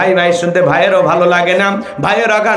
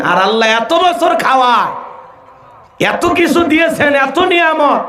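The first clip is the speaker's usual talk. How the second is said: impassioned rise; 6 semitones higher, slower than usual. Acted. Preacher emotional climax.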